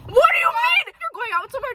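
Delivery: high, loud disbelieving question; mock disbelief. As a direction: ask it scandalized. Indignant retelling.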